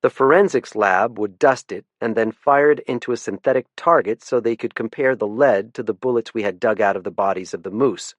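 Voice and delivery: usual speaking voice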